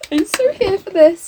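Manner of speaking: high, loud, eager delight; thrilled. Real, not acted. Player delighted the ghost has turned up, thrilled. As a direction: gush with excitement.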